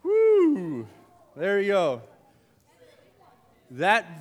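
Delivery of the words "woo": high, loud whoop as it flies; excited whoop. Real, not acted. Youth pastor tossing a diaper at campers, excited whoop. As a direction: let out a whoop while tossing it.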